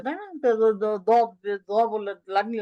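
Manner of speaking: ordinary talk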